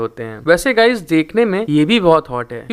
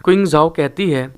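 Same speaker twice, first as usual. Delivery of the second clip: plain reading voice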